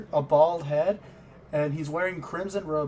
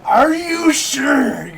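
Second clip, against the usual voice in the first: loud, drawn out, higher warning; game master reacting to the player's risky choice; incredulous shout; 9 semitones higher, 12 dB louder, much slower than usual. Real, not acted. Slow, loud, higher disbelief.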